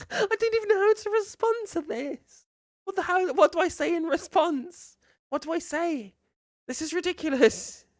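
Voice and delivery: high-pitched, helpless disbelief; flabbergasted. Real, not acted. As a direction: sputter high, at a loss for a response. Lost for response, laughing.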